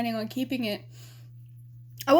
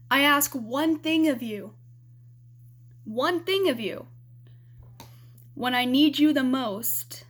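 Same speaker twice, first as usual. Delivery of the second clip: hurt reproach; 3 dB louder than usual. Retold or imitated. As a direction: confront him, leaning hard on each accusation. Retold quarrel, reproaching her boyfriend.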